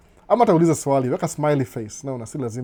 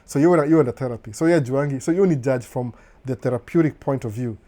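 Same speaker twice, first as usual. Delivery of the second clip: ordinary talk, nothing marked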